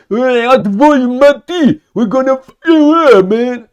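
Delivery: high, booming mock shout; hammed-up Russian cursing over the radio; aggressive Russian radio threat. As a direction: yell it like a comic threat. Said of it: "It was a particular aggressive radio exchange".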